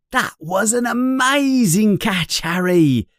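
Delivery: put-on high, eager boyish voice; excited friend. Ron congratulates Harry on his catch, high and eager. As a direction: cheer your friend on brightly.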